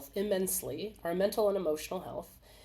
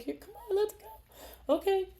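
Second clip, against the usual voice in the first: mock eager crier; 10 semitones higher than usual. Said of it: "You're like".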